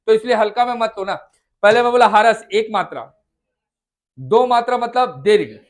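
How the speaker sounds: loud, fast warning; scolding teacher. Real, not acted. Teacher warning students not to take it lightly, loud. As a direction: warn the class firmly not to slack.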